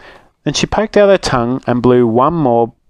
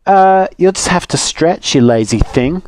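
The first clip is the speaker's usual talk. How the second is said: put-on bratty girl, higher and dismissive; rude sneer; 7 semitones higher than usual. Little Rude mocking the woodcutter, bratty and dismissive. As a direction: brush him off like a spoilt child.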